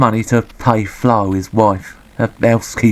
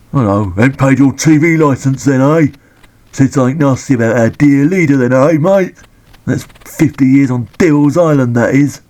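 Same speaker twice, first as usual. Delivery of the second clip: higher, louder cocky London copper taunting; mock bully copper; 3 semitones higher, 4 dB louder than usual. Reviewer voicing a copper picking on Andy, hammed-up and cocky. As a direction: lean in like a smug bobby threatening a suspect.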